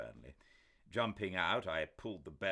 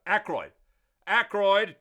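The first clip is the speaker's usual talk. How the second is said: loud, much higher call through the door; 20 dB louder than usual. A narrator's reading. Doctor calling to Ackroyd through the locked door. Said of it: "called out".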